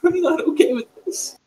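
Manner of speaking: very high, whimpering protest; acted distress. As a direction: whine it shakily, near tears. Character upset after voice in head.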